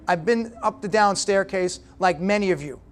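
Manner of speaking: usual speaking voice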